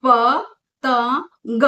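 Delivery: very slow पतंग, nasal stretched; drawn out nasal. Shown as a demonstration. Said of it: "बीच वाला है". (धीरे-धीरे खींचकर पतंग)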